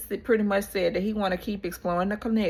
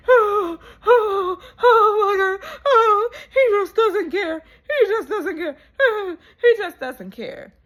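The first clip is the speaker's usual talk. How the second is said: hammed-up high, loud wailing with laughs; imitating a castmate's crying fit, laughing through it; mock sobbing; 12 semitones higher, 7 dB louder than usual. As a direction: wail it like a melodramatic breakdown. Retelling castmate's crying outburst.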